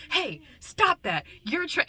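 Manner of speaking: high, drawn-out mock protest; Fred. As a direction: imitate a flustered movie character objecting. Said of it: "when Fred was like".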